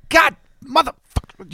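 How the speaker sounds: high-pitched exasperated swearing; imitated cursing. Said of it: "and she was cursing".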